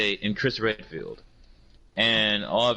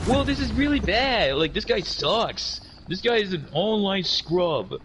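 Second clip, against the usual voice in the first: opponent's trash talk; 8 semitones higher, slower than usual. Retold or imitated.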